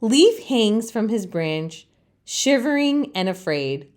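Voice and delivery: usual speaking voice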